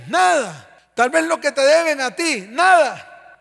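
loud, driving sermon at full force; fiery preaching. Acted. Pastor thunders at the church about forgiveness, loud preaching. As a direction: preach it hard to the congregation.

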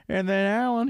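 ordinary delivery

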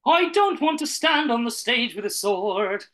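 loud, high, slow Irish lilt; hammed-up Irish accent, sung out slowly. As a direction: belt it out in a put-on Irish voice. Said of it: "How about your Irish again?".

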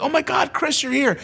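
high-pitched gushing greeting; popular girls. Retold or imitated. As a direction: imitate popular girls greeting him excitedly. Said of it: "the popular girls came".

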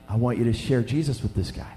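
low, soft voice of the Lord; quiet inner voice. Retold or imitated. The Lord prompting a reluctant pastor, low and quiet. The Lord speaks in his heart.

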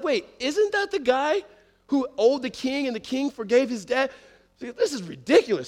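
high-pitched, slowed-down incredulous question; acted disbelief. King's servants spotting the forgiven debtor choking someone, incredulous. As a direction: question it in astonishment, pitched well up.

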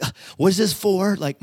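high, exasperated mock wife; annoyed wife. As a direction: play the irritated wife holding the dog back. Wife voiced, annoyed at visit.

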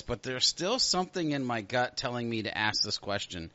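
normal talking voice

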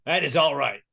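ordinary delivery

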